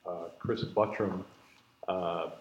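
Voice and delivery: ordinary delivery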